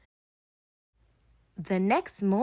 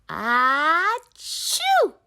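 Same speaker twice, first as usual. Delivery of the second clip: high loud piglet sneeze; 8 semitones higher, 5 dB louder than usual. Acted. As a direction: let a big sneeze burst out. George sneezing again.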